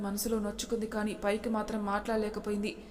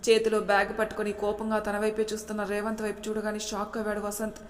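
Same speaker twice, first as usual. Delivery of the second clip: ordinary delivery, nothing marked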